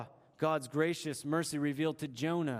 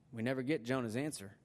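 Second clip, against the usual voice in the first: ordinary delivery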